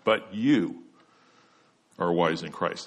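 "you" held out and followed by a long pause; stress on YOU. Shown as a demonstration. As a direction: lean on "you", pause, then drop for the rest. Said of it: "That's the emphasis".

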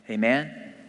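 normal talking voice

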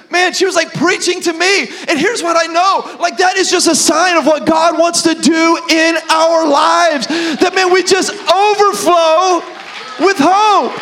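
high, loud sermon climax; full-force preaching. Real, not acted. Pastor telling the church what God wants, full-force preaching. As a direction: build to a drawn-out, ringing finish.

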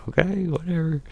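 high, dismissive okay whatever; imitating the cops' offhand dismissal; mock dismissive cops. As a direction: squeak it out with a shrug. Cops giving up.

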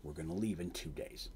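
low, quiet, controlled orders; very calm anger. Acted. Angry captain orders his crew to ready the boat, very calm. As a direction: keep the fury hushed and low, voice very calm. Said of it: "because he's very calm".

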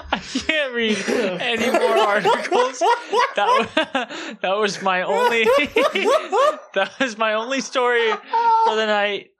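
high voice breaking into laughs; words broken by real cackling; helpless laughter. Real, not acted. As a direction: give up through a laughing fit. Cannot recover from own blunder.

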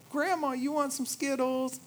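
put-on high, bright little-kid voice, thanks rising; squeaky happy toddler thanking dad; toddler's delight. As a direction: play a thrilled two-year-old. Voicing toddler offering grandma.

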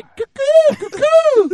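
high, loud, drawn-out cuckoo whoop; mock signal call. Joking that she's signalling all her girls, mock signal call. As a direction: imitate a gangster girl hollering a signal. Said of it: "trying to signal all her girls".

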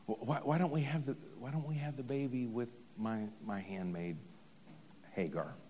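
lightly voiced old woman, soft and low; a hint of a frail old wife, fading out. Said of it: "let me kick into my old woman voice".

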